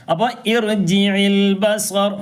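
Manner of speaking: ഇർജിഇൽ ബസർ slow with the hamza read as "i"; recitation demo. Shown as a demonstration. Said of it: "ഈ എന്നാണ്".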